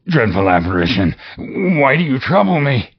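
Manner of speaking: put-on scared old man; frightened plea. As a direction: question the phantom in fear. Scrooge pleads.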